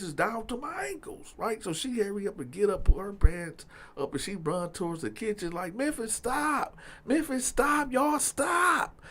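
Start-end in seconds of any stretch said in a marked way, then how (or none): 5.7-9.0 high, drawn-out mock shout without real volume; pitched-up retold yelling; imitated pleading yell. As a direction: imitate a woman begging fighters to stop. Imitating Kim yelling during the fight.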